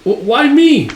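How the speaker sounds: high, loud stammered objection; squawked acted disbelief; dismayed protest. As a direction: balk at being sent in. Reluctant man told to attack.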